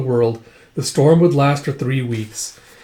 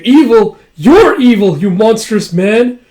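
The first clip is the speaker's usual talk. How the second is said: high, loud accusation, slowing at the end; acted outrage; 8 semitones higher, 8 dB louder, slower than usual. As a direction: fling it as an indignant accusation. Victim angrily accuses the villain.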